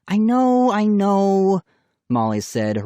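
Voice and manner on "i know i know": exasperated. A narrator's reading. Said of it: "rolling her eyes".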